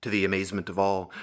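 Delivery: ordinary delivery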